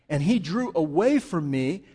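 ordinary delivery

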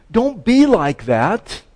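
high, loud, slow command; urgent preacher's plea; emphatic exhortation. Acted. Preacher voicing Paul's emphatic exhortation.

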